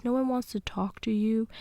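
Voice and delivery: ordinary talk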